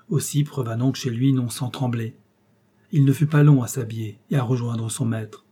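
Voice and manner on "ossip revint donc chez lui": usual speaking voice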